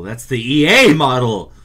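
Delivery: loud exclamation. Real, not acted. Outraged comparison to EA.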